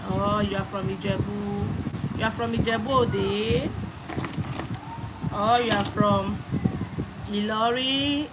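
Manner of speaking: normal talking voice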